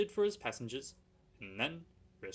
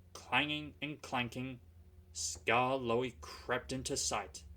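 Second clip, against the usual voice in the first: usual speaking voice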